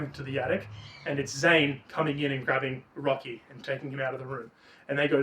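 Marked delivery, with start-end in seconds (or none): none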